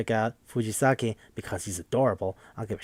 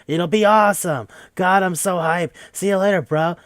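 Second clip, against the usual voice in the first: loud, higher-pitched bro excitement; hyped biker bro; 7 semitones higher, 7 dB louder than usual. Retold or imitated. Biker inviting the player for a ride, hyped. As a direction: barrel over the refusal with hype.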